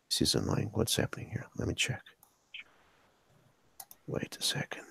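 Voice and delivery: soft whispered aside to himself; muttering under breath. Real, not acted. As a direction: mutter it off-mic, almost whispered. Host fixing screen share, muttering aside.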